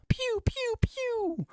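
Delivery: very high squeaky pew pew blasts; cartoon laser noises. Narrator mocking the battle with high toy laser noises.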